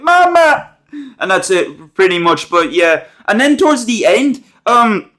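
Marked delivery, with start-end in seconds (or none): 0.0-0.8 put-on high, loud child's cry of mama; sudden high-pitched shout of Mama; mute girl's cry. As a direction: call out for mama like a scared girl. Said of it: "She says, like, one thing".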